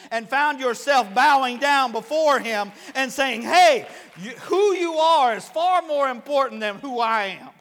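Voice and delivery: loud, driven sermon delivery; full-force preaching. Acted. Preacher to congregation on bowing before the King, full-force preaching.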